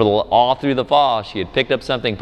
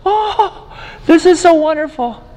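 put-on very high, squealing toddler voice with a laugh; little girl's delight. Dad imitating his delighted toddler daughter, high squealing voice. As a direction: squeal it like a thrilled three-year-old. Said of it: "She's like".

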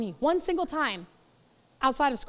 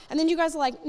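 ordinary talk

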